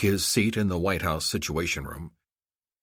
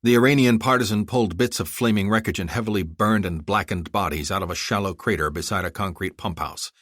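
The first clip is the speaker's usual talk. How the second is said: usual speaking voice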